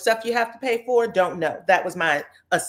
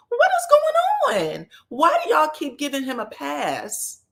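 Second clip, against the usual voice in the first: exasperated disbelief; 7 semitones higher than usual. Real, not acted. Recapper to viewers excusing the man, high-pitched exasperation. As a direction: let the pitch leap way up, incredulous.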